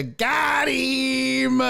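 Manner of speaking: teasing gotcha line; mock gotcha call. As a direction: quote the taunt with a playful shout. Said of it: "hit him with the".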